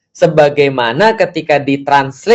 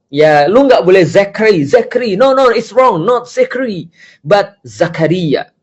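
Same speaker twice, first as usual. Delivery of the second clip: high, bossy no no it's wrong; mock pushy corrector; 5 semitones higher than usual. Speaker mocks people who force others to say Zakaria, bossy and high. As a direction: play someone forcing the name Zakaria. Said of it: "memaksa orang untuk".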